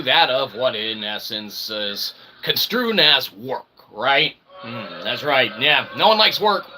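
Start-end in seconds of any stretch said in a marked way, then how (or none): none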